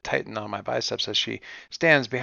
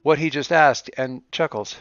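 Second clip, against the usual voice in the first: ordinary talk, nothing marked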